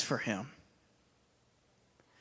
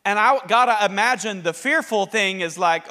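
ordinary delivery

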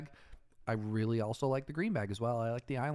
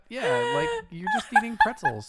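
high, louder laughing words; 12 semitones higher, 6 dB louder than usual. Real, not acted. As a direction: laugh out the tease. Teasing friend's pretzel snacks.

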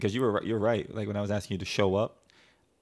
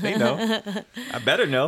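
laughing, higher and louder; husband laughing at his wife's joke, louder; 11 semitones higher, 6 dB louder than usual. Real, not acted. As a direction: tease her through laughter. Teasing banter, laughing.

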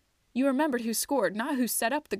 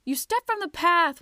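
loud, much higher accusing yell; angry shout; 8 semitones higher, 7 dB louder than usual. A narrator's reading. A troll shouting at Twig for leaving the path, loud. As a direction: shout him down for breaking the rule. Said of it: "one of them shouted".

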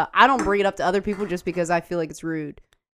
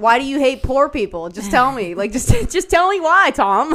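raised, pleading mock interrogation; loud, high comic exasperation; retold tipsy grilling; 4 semitones higher, 6 dB louder, slower than usual. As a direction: demand an answer, hammed up.

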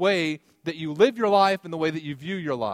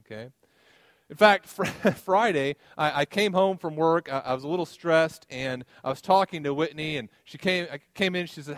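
ordinary delivery

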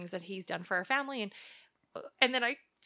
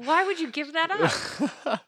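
incredulous roommate; 8 semitones higher, 6 dB louder, slower than usual. Retold or imitated. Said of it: "this girl's like".

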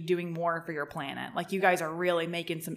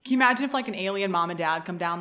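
ordinary talk

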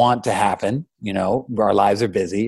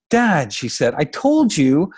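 exasperated daughter; 7 semitones higher, 4 dB louder, slower than usual. Acted. Exasperated daughter answering her father.

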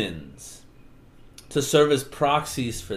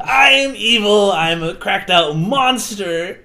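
hammed-up villain rant; 5 semitones higher, 6 dB louder than usual. [name] gloating at Darren, hammed-up shouting rant.

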